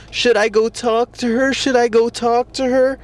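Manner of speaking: high-pitched fretful self-questioning; mock dithering. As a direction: mimic an indecisive guy looping on himself. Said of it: "thinking about".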